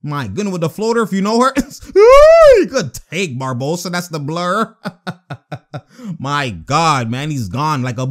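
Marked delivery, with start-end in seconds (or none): 2.0-2.6 high laughing whoop of delight; excited laughing whoop. Real, not acted. Reacting to a highlight, laughing whoop.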